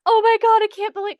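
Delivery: high, loud mock-horrified exclamation; mock shock. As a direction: imitate everyone reeling in disbelief. Group reacts to a murder.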